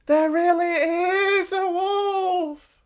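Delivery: put-on high, slow pleading boy; desperate plea. A narrator's reading. Boy pleads no one believes, high slow plea. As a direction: make them believe you.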